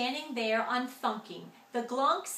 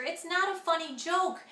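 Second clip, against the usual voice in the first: panicky plea; 5 semitones higher, 5 dB louder than usual. A narrator's reading. Panicked sister pleading.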